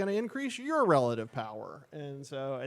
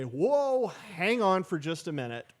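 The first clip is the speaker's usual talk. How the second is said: higher, louder "whoa" easing down; halting a crowd; 5 semitones higher, 5 dB louder than usual. Acted. Standing up to frustrated Americans, urgent halt. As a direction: call a halt to a frustrated group.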